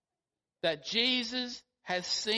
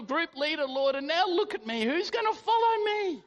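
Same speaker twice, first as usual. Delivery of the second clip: raised, pleading confession to the Lord, climbing higher at the end; Peter's anguished lament; 6 semitones higher than usual. Acted. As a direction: pour out Peter's remorse as a broken prayer. Peter's imagined prayer after denial.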